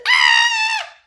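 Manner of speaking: high shocked shriek; very high startled yell; startled scream. Acted. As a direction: yelp in fright. Walrus startled.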